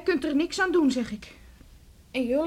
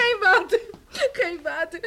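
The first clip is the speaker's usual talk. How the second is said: sobbing refusal; 4 semitones higher, 3 dB louder than usual. Acted.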